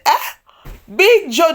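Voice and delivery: a friend aghast at the news; disbelief. Retold or imitated. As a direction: cry out in disbelief. Ken's disbelief continues.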